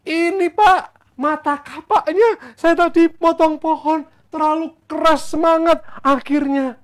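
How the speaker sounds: loud, high-pitched acted explaining; distressed student role. Student explains the accident to Elisha, loud high distressed voice. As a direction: plead your case loudly in a high voice.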